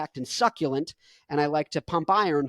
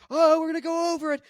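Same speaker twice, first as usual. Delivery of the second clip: excited rider; 10 semitones higher, faster than usual. Pretend. Acted dune buggy rider.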